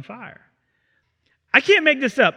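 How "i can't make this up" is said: normal talking voice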